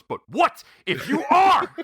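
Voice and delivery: ARE pitched very high and drawn out; odd emphatic stress. Retold or imitated. Colonel Mustard culminates the argument, huge stress on "are". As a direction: punch "what", then blow up on "are". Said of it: "the emphasis".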